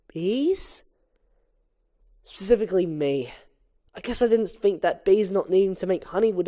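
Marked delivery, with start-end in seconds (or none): 0.1-0.8 incredulous echo. Acted.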